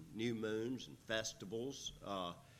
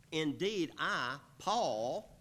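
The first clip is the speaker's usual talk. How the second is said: "I, Paul" punched louder and higher; emphasis on Paul; 4 semitones higher, 5 dB louder, slower than usual. Shown as a demonstration. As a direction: stress "I, Paul" firmly, pause after "I". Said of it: "notice the emphasis there".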